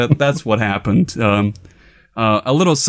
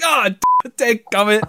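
high, strained cry of pain after a fall; pained exclamation; 8 semitones higher than usual. Retold or imitated. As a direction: groan it out after hitting the stairs. Face-planting on the stairs.

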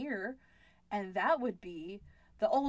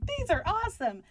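higher, mock-thrilled exclamation; retold excitement; 9 semitones higher than usual. Author recalling writing accents in their head, mock excitement. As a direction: gush like a delighted writer imagining it.

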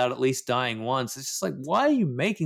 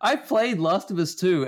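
ordinary talk, nothing marked

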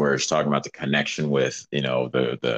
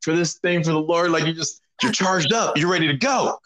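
much higher, fired-up and eager; retold excited high voice; charged-up reply; 11 semitones higher than usual. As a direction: answer like you're buzzing for the Lord. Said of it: "And I go".

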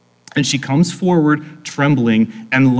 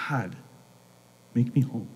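hushed, halting plea with long pauses; a small, desperate plea to God; lonely prayer; 4 semitones lower, 10 dB quieter than usual. Acted. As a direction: pray it low and soft, almost to yourself. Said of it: "we can almost, like, hear her prayer".

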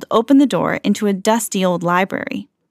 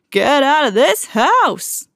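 slow threatening skeleton cry; menacing cry; 6 semitones higher, slower than usual. A narrator's reading. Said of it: "he cried".

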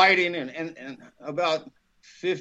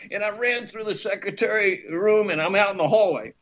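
ordinary delivery

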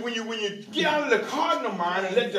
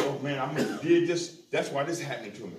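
muttered inner thoughts; 8 semitones lower, 6 dB quieter, faster than usual. Retold or imitated. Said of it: "I said".

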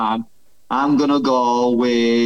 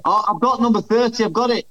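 excited; 8 semitones higher, faster than usual. Real, not acted. Remembers the answer in quiz.

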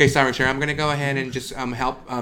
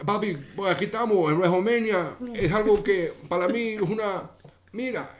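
lightly voiced Puerto Rican star in Spanish with a Cuban lilt; mock Latin pop star chatter in Cuban-tinged Spanish; 3 semitones higher than usual. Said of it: "I'm still doing the little Cuban at the end".

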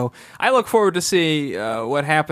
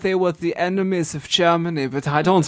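put-on lightly done German accent; a hint of German in the vowels. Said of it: "when I switched into my German accent".

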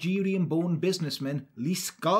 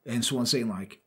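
normal talking voice

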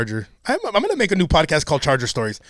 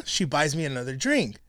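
usual speaking voice

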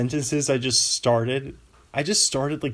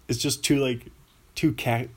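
ordinary talk

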